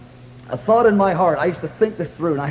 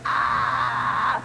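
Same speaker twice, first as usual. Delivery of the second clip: falling cry; 7 semitones lower, 4 dB quieter than usual. Retold or imitated. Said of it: "I was going like".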